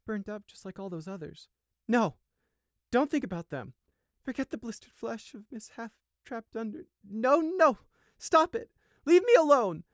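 high distress building to loud pleading; panicked. A narrator's reading. Sheep begs horrible thoughts to stop, panic building. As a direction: fight off the thoughts, rising into a high cry.

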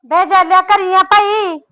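a woman calling to a man inside; calling out again. Acted. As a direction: holler up at the house, asking if he's in. Calling at the neighbour's door.

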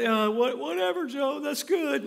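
high, loud mocking brush-off; dismissive scoffer. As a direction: wave off the preacher like a bored skeptic. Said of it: "I feel like".

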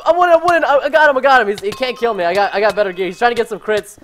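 loud, high hype yelling; excited shouting. Real, not acted. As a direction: yell the fight as it happens. Live battle commentary yelling.

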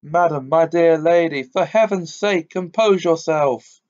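high, loud, panicky plea; trembling scream. Acted. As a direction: beg her in terror to calm down. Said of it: "in a sort of trembling scream".

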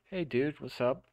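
a mock chill goblin saying hi; casual goblin. Occupying goblins greeting the player, breezy mock voice. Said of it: "they're just like".

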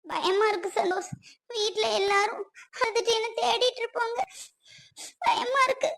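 slow and frightened, breaking into a cry at the end; scared, near tears. Acted. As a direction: plead with him, fear turning to tears. Frightened wife alone in room. (பயத்தில் அழுகை கலந்த குரல்)

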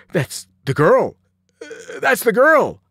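loud, slow, dopey outburst; drawn-out, loud exclamation of recognition; dumbfounded boy. A narrator's reading.

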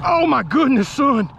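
high excited exclaim. Real, not acted. Feeling the weight on the line.

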